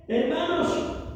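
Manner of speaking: usual speaking voice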